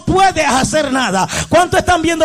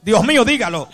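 ordinary delivery

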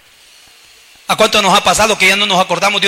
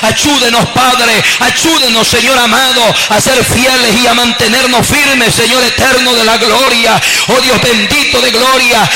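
louder fervent prayer over music, building; oración fervorosa; 3 semitones higher, 4 dB louder than usual. Real, not acted. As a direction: pray aloud with rising fervor to God. (oración en voz alta y ferviente)

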